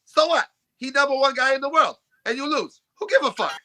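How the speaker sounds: high-pitched, blunt mock Polish accent. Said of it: "why does your Polish accent sound like that".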